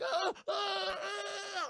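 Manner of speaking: high panicked shrieking; mock panicked shrieks. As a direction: shriek like a hand is grabbing your face. Governor chased by hand.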